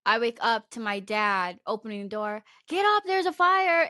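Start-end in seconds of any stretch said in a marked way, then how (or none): 2.7-3.9 raised urgent call, slow and high; urgent yell. Retold or imitated. Dad waking daughter for a fire, urgent raised call. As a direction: wake someone up about a fire.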